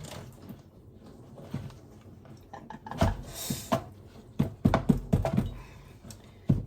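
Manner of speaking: long breathy laugh at herself; burst of laughter. Real, not acted. Laughing at her messy kitchen, a breathy giggle.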